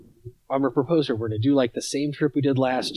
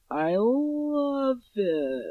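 fake polite gushing; 11 semitones higher, 3 dB quieter, much slower than usual. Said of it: "gives me, like".

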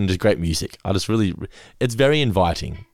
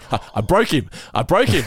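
gleeful crow, rising higher on the repeat; triumphant retold; 8 semitones higher than usual. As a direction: celebrate a small victory, building into laughter. Teller crowing over beating rude man.

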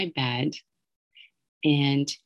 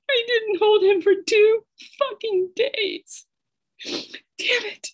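very high, choked with tears, fading to a whisper; real crying. Real, not acted. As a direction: break down, then whisper through it. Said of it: "that's so hard on me still".

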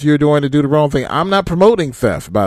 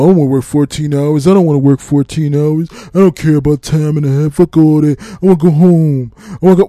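louder mock griping of an angry driver; pissed-off complainer; 4 dB louder than usual. Imitating the angry driver next door refusing long shifts, loud. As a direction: rant like a worker who just wants to go home. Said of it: "he's all pissed off".

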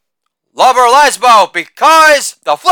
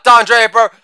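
usual speaking voice

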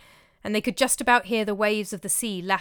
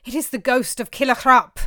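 louder, wailing moan; 4 semitones higher, 7 dB louder than usual. A narrator's reading. Frightened housecarl telling Olaf about the ghost, moaning. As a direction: moan it out in dread. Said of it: "moaned the housecarl".

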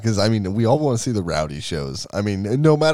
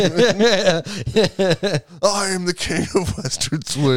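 screaming; 9 semitones higher, slower than usual. Retold or imitated. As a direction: belt the boast out in the car.

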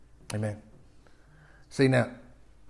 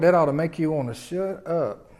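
ordinary delivery

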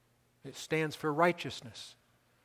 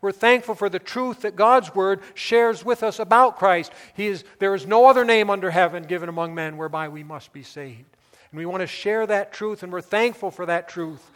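loud, higher-pitched preaching; impassioned preaching; 7 semitones higher, 11 dB louder than usual. Real, not acted. Preacher thanking God for His truth, impassioned preaching. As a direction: proclaim it forcefully to the congregation.

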